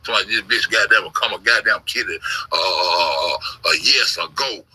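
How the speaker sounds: usual speaking voice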